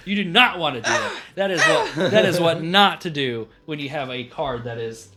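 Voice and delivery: ordinary delivery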